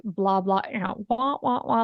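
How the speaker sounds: muffled blah-blah drone like a cartoon adult; a mock wah-wah droning voice; Charlie Brown mom noise. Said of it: "like the Charlie Brown mom".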